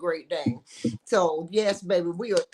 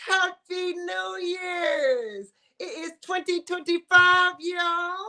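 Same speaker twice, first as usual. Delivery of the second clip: excited shout; 6 semitones higher, slower than usual. Real, not acted. Host shouts New Year greeting.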